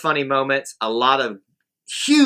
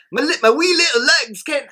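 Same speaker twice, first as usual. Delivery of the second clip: high, put-on Scottish old man voice; 13 semitones higher, 4 dB louder than usual. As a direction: squeak it like a wee old Scot. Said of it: "I'm doing it in the old man voice".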